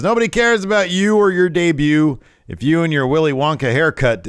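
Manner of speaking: high, loud retold heel promo; mock taunting. Waller on the mic to a rival, mock taunting. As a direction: jeer like a cocky wrestler.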